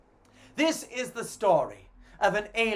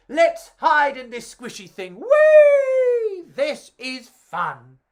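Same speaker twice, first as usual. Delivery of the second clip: a high squeal of glee on whee; playful sausages; 7 semitones higher, 8 dB louder, slower than usual. Acted. Sausages hiding in a squishy bun, gleeful. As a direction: squeal with fun, then slow down.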